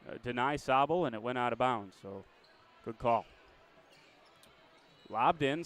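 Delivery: brisk live game call, rising with the action; play-by-play. Acted.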